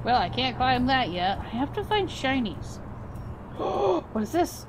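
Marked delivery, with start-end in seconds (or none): 2.8-3.5 quick unvoiced gasp of discovery; soft gasp. Real, not acted. Player spots something new and gasps quietly. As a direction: draw a sharp little breath in surprise.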